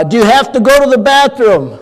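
high, loud caregiver voice repeated for a deaf man; nearly shouting to be heard; a nurse raising her voice at a hard-of-hearing patient; loud into his ear. Retold or imitated. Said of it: "would speak loudly in, a, in his ear".

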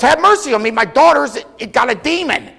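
mother crying after the disciples for her daughter. Retold or imitated. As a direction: call after them, begging. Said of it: "She cried after the disciples".

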